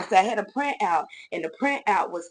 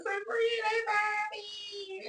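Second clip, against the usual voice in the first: much higher, slow, whining to a friend; crying out to her best friend for help; upset high-pitched plea, drawn out; distressed wail; 5 dB quieter, much slower than usual. Retold or imitated. Calling friend after being fired.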